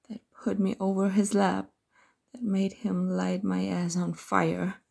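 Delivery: ordinary delivery, nothing marked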